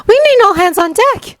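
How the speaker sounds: high-pitched urgent call. A narrator's reading. Blonde woman hailing a hero on the battlefield, calling out. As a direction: call across the fight in a high voice. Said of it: "called out a blonde-haired woman".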